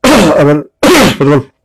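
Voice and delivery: clearing a sore throat; throat irritated after swallowing a pill; pill stuck in throat. Real, not acted. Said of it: "Hace rato se me atoró, una pastilla y me lastimó".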